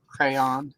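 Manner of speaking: "Crayon" said the Southern way; Southern pronunciation. Shown as a demonstration. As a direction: give "Crayon" a Southern accent. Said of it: "tell I'm from the South when".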